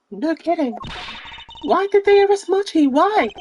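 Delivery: put-on high-pitched worried young woman; high female voice. Streamer voicing the upset young woman, high-pitched.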